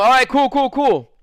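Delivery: loud, high-pitched repeated okay; eager agreement. Retold or imitated. Answering his friend about the address, eager retold agreement. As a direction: agree brightly and loudly, retelling it.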